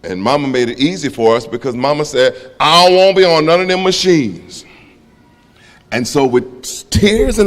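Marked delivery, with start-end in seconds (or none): 2.6-4.6 higher-pitched voicing of mama's refusal; imitated firm refusal. Mama refusing the machines, firm high refusal. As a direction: lift it up and hold it, as mama.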